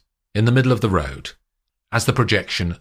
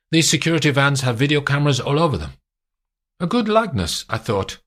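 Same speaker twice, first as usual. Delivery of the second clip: hint of a sly French policeman; lightly voiced commandant; 6 semitones higher, faster than usual. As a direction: slide the evidence across, knowingly. Moreau confronts with photograph.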